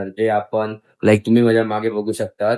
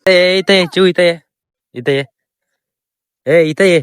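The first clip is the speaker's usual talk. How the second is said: loud high calls to the dog; calling out; 7 semitones higher, 5 dB louder than usual. Real, not acted. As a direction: call the dog over loudly. (कुत्र्याला मोठ्याने हाक मारणे)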